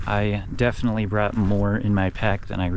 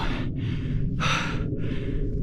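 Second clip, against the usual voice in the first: heavy sigh; 6 dB quieter than usual. Real, not acted. Resting, gazing at the view.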